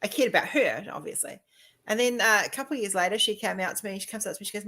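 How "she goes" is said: usual speaking voice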